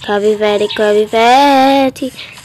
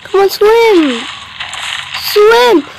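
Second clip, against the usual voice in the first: high, loud shouted swim; frantic yell; 10 semitones higher, 4 dB louder, slower than usual. Real, not acted. Urging character to swim away.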